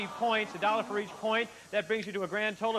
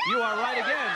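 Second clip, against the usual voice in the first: excited; 4 semitones higher, 6 dB louder than usual. Real, not acted. Host confirms answer excitedly.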